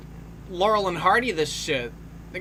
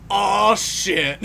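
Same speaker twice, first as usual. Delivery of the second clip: mock shock; 4 dB louder than usual. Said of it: "gave that feeling of".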